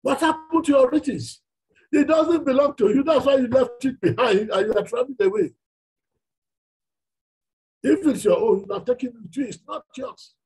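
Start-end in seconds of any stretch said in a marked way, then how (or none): 0.0-5.5 raised preaching voice. Real, not acted. Preacher raising voice to congregation.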